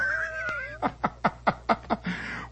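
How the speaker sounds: soft unvoiced giggle, high up; breathy high chuckle; high breathy laugh. Real, not acted. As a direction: laugh under the breath at the memory. Teller laughing at the scene.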